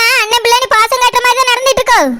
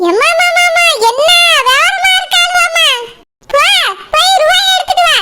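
scornful shout; 6 semitones higher than usual. Acted. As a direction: bark the order with a sneering laugh. Mother-in-law scolds daughter-in-law. (கேலிச் சிரிப்புடன் உரத்த கட்டளை)

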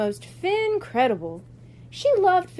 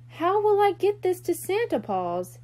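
put-on higher, whiny child's complaint; whining; 8 semitones higher than usual.

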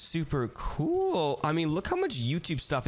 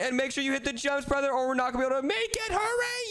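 urgent; 10 semitones higher than usual. Acted. Urging brother over big vent.

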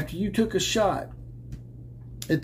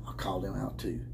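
a quick unvoiced whisper; whispered aside; 8 semitones lower, 12 dB quieter than usual. Real, not acted. Aside in low voice.

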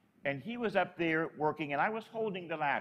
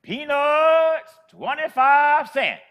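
loud, high, slow street-vendor cry; drawn-out hawker's shout; vendor's call; 9 semitones higher, 13 dB louder, much slower than usual. Retold or imitated. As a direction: call it out like an old peanut seller. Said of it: "she yells out".

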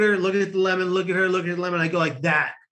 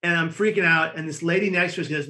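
usual speaking voice

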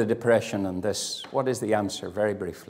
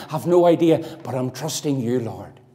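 ordinary talk, nothing marked

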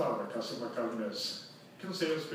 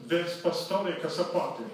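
calling across; 4 semitones higher, 5 dB louder, slower than usual. Retold or imitated. Man shouts from four meters.

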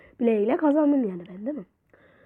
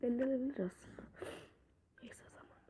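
fast unvoiced whisper; whispered mutter; 23 dB quieter, faster than usual. Real, not acted. Gamer muttering to himself mid-match, whispered. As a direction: hush it to yourself.

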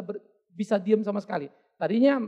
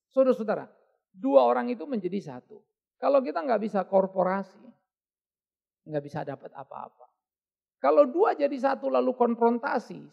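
ordinary talk

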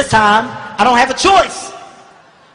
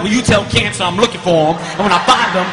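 raging uncle; 3 semitones higher than usual. Retold or imitated. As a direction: yell each threat like a madman.

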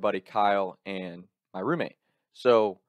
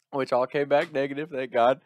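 ordinary talk

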